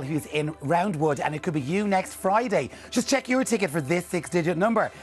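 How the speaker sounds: usual speaking voice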